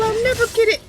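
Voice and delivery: high whiny "I'll never get it"; mock despairing whine. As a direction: moan it like a hopeless beginner. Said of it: "I was like".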